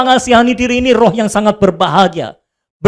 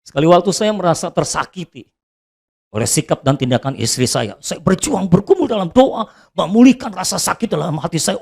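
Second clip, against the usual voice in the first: ordinary delivery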